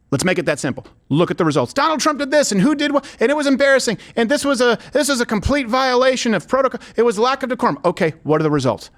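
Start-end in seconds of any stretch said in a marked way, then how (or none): 1.7-7.8 high-pitched parody of indignant critics; mock outrage. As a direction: imitate pundits getting worked up, higher pitch. Mimics outraged critics of Trump.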